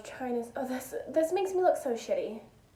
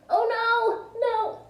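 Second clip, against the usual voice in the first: higher, louder mock dismay; 11 semitones higher, 6 dB louder, much slower than usual. Telling viewers how she reacted on the call, higher mock dismay. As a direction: yelp out a retold oh no. Retelling her reaction on the phone.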